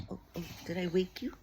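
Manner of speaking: usual speaking voice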